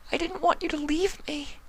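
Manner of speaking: high, soft tearful plea; sobbing. Acted. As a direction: cry it into his shoulder. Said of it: "she sobbed into his shoulder".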